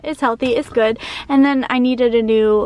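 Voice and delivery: usual speaking voice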